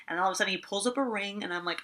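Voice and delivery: ordinary delivery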